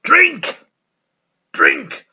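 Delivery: loud, high, frantic bellow of one word; a drunk old priest roaring for his drink; Father Jack yelling for drink. Retold or imitated. Father Jack in the AA group, roaring for drink. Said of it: "and he's like".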